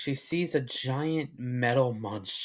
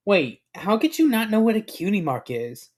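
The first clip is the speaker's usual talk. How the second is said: higher-pitched disbelieving question; incredulous; 6 semitones higher, 4 dB louder than usual. A narrator's reading. Filly presses the stranger about cutie marks, incredulous.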